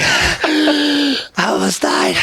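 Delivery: high, drawn-out gasped words; out of breath. Retold or imitated. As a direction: imitate a diver gasping after surfacing. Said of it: "trying to catch his breath".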